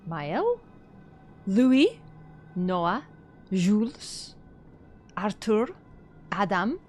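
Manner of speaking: unsure French accent drifting Polish; tentative French-Polish accent, name by name; French sliding into Polish. Real, not acted. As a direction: read each name like a question in shaky French. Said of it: "I feel like I'm going Polish".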